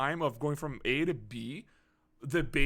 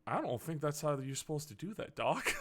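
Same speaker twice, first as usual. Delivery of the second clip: deadpan aside; 9 dB quieter than usual. Retold or imitated. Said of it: "I'm just sitting there and I'm like".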